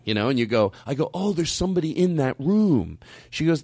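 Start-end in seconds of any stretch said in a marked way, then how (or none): 1.1-3.0 higher, falsely pleasant report; hiding annoyance. Acted. Guest reporting occupied room to clerk, hiding annoyance. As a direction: cover irritation with put-on politeness. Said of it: "you hide everything".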